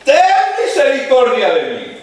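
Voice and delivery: grito agudo y fuerte, suplicando desesperado; más fuerte aún. Acted. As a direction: clama más alto, que te oiga entre todos. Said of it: "él levantó más la voz y dijo". (el ciego, mandado callar, grita aún más fuerte a Jesús)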